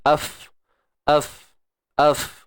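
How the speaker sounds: Af said with light lips, like the letter F; af sound. Shown as a demonstration. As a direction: breathe Af out through the lips. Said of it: "Macam kita sebut huruf F".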